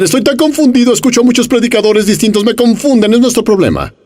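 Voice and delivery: high whiny imitation of a confused believer; mock confused churchgoer. Preacher mimicking a confused congregant, high mock whine.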